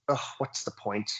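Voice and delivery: a hint of giving up, sighed out; resigned. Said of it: "resignation means".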